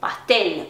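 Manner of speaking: pastel with a real l at the end; pronounced final l. Shown as a demonstration. Teacher repeating Spanish pastel, clear final l. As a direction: land pastel on an l, not a u. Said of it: "Ele tem que pronunciar o L".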